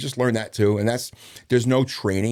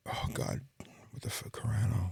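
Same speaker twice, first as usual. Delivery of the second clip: whispered, searching memory; muttering to self; 11 dB quieter than usual. Real, not acted. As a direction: think aloud under your breath, grasping for a name. Straining to recall a victim's name.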